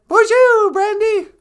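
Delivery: very high, loud cartoonish greeting; shrieked hello in a tiny sidekick voice; high-pitched excited welcome; squeaky sidekick.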